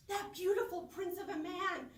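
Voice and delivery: ordinary delivery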